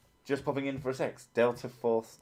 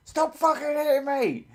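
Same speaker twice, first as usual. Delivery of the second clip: re-enacted outburst, very high and louder; pissed-off high squeal; 6 dB louder than usual. Retelling snapping at partner for hitting, high-pitched angry squeal. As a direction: screech it like you've finally snapped. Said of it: "until I got really fucking pissed off".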